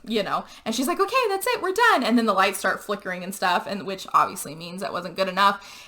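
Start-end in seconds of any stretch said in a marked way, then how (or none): 1.0-2.0 high-pitched retold declaration; acted eagerness to be finished; hurried finality. Girlfriend retold after a weak hit, calling it done high-pitched.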